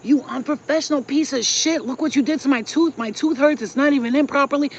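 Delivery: loud, high angry yelling at the dentist; patient yelling at a dentist who botched her tooth; retold screaming. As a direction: scream it at him, furious. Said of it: "Started screaming at him".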